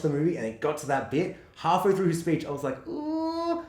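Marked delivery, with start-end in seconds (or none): 2.9-3.6 high, softer doubtful voice; hesitant mock reaction. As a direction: wince it out high and tentative. Voicing own doubtful reaction.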